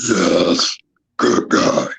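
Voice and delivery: low, rough whispered "Yes, good guy"; deep gravelly voice. Retold or imitated. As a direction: rasp it out like a creature from the woods. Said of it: "in the same voice".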